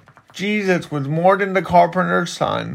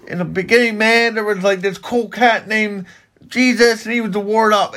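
higher-pitched parody of a would-be hip Bible; mock cool-and-hip preacher; 6 semitones higher, faster than usual. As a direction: put on a trying-too-hard hipster retelling. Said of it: "horrible attempt to try making Jesus cool and".

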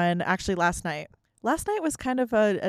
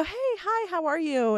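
bright greeting; 9 semitones higher than usual. Retold or imitated. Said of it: "So I go".

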